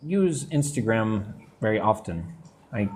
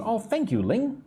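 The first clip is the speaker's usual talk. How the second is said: ordinary delivery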